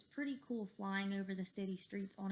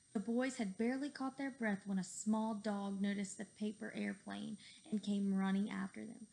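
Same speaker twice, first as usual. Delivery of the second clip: plain reading voice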